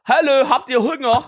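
high, slow East German impression; slow, high East German dialect imitation; East German accent. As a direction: call out like an East German man feeding ducks. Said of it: "wie war dieser Dialekt? Von so Ostdeutsch?".

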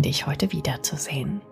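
usual speaking voice

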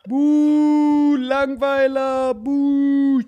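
high, drawn-out jeering boo; mock booing. Real, not acted. Friend boos the other's dull adoption speech, high mock jeer. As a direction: boo him off like a bored heckler.

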